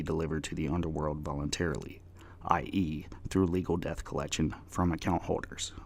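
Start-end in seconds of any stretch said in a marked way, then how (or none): none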